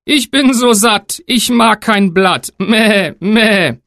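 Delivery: high, slow goat voice; bleating goat. Acted. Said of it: "Die Ziege antwortete".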